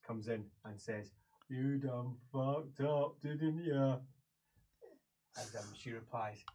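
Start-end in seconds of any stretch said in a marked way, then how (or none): 1.5-4.0 drawn-out, slow reproach; slow scolding. Acted. Man telling off a prisoner who messed up, slow reproach. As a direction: let each word drag out in reproach.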